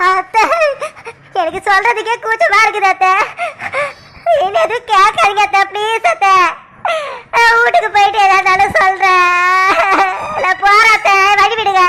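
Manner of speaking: heavy acted sobbing plea; crying. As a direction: beg through sobs to be let go. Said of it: "ஏன் அழுதுகிட்டே இருக்க?".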